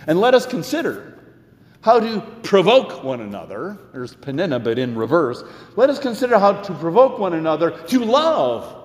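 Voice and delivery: earnest, rising preacher's cadence; sermon delivery. Acted. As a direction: preach it to the congregation.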